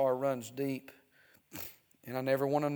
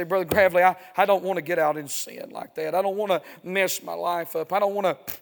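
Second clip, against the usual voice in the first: put-on louder, earnest young person's plea; imitated young churchgoer; 4 semitones higher, 9 dB louder than usual. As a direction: voice a young believer pleading with the preacher. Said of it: "you young people say".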